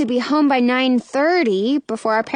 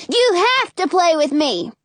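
put-on high-pitched bossy child; insistent little boy; 6 semitones higher than usual. A narrator's reading. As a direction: insist like a small boy who wants a playmate.

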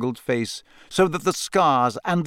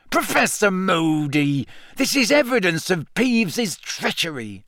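Filch voice; 6 semitones higher than usual. A narrator's reading. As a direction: plead like a fussy caretaker. Filch pleading to Moody.

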